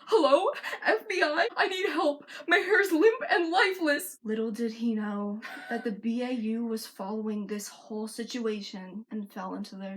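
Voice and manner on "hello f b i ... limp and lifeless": high, loud, hammed-up panic; screaming. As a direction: shriek a comically desperate plea for help. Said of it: "see him pull out his phone and scream".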